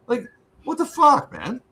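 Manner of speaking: high, incredulous what the fuck; exasperated outburst. Real, not acted. Venting about lazy friend.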